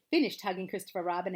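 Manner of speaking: ordinary delivery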